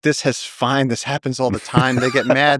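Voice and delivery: high, airy, it's-all-fine voice; mock breezy reassurance. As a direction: brush off the danger, breezy and high. Reassuring scared guests after chase.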